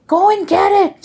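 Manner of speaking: high, sharp repeated order; mock stern nun. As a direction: bark it like a strict nun.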